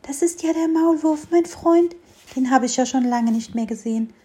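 a hint of a small curious fir tree; little fir tree. Little fir tree talks to mole. (kleiner Tannenbaum, leicht höhere Kinderstimme)

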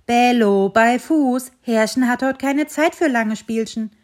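loud, firm call to the dog; dog owner summons Bello sternly. Acted. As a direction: call him to heel, loudly. Owner calling his dog.

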